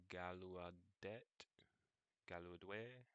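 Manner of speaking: Gallaudet tried slowly with a questioning rise; unsure pronunciation. Real, not acted. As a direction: sound out Gallaudet as if unsure how to say it. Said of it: "don't know how to pronounce that".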